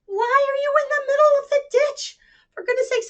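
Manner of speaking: high, loud fussing farmer; astonished farmer. Acted. Farmer to the sheep, high and fussing. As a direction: fuss over the silly sheep.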